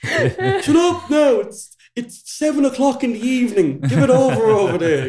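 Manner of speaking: high, loud mock complaint; hammed-up shout over the back wall; irate Dublin neighbour. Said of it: "If you did that in Dublin".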